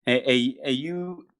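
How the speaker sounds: ordinary talk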